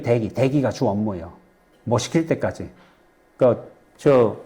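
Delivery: ordinary talk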